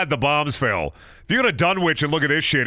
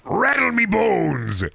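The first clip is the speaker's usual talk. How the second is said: high, drawn-out skeleton cry; 8 semitones higher, much slower than usual. Acted. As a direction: stretch it into a slow skeletal wail. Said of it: "In a skeleton voice again?".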